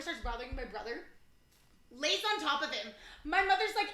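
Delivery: ordinary delivery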